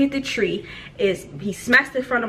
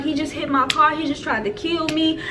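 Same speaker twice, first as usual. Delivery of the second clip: higher-pitched, mildly frantic complaint; retold hysterical crying; 7 semitones higher than usual. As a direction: report it as if half in hysterics. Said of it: "I'm hollering and screaming and crying".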